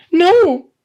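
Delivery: very high loud gasp. Acted. Woman crying out as her handbag is found, very high. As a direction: cry out in panic. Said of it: "the woman gasped".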